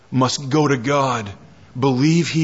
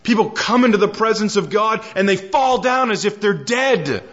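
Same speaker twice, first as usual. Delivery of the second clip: high, ringing preacher's voice, pressing the point home; fervent preaching; 8 semitones higher than usual. Acted. Preacher urging the congregation, fervent preaching. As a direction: preach it up to the rafters.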